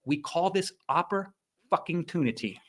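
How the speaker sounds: slow, leaning on fucking inside oppor-fucking-tunity; oppor-fucking-tunity, the fucking drawn out for effect; stress on middle word. Shown as a demonstration. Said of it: "I stress that middle word".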